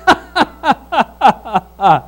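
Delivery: a drawn-out imitated laugh; Abram's laugh. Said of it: "That's what Abram did".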